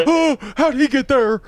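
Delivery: high, loud comic shock; mock startled. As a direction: jump back as if spooked by your own reflection. Mock startle at mirror clown.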